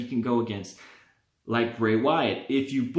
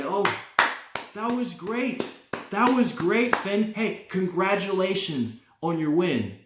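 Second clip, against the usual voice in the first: sarcastic fake praise, pitched higher; mocking taunt; 7 semitones higher than usual. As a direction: needle him with mock congratulations. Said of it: "start to taunt".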